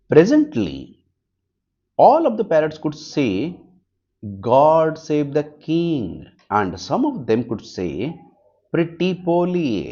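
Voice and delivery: slow, emphatic reading voice; reading aloud. Shown as a demonstration. Teacher reading the parrot story aloud. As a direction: read it to the class clearly.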